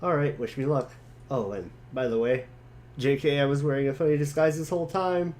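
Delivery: lightly voiced jokey boy. John brushing off his friend's teasing, quick and breezy. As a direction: rattle it off like a cheeky kid. Reads John's chat lines.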